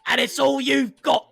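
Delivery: high, loud, anguished cry; desperate outburst. Acted. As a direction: shout it as a final desperate plea. Woman's desperate last plea.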